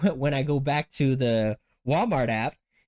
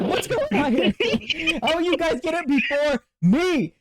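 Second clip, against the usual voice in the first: high, exasperated outburst with laughter; high-pitched retold griping; pissed-off protest; 3 dB louder, slower than usual. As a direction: wail it in comic frustration. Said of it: "I'm all pissed off about it".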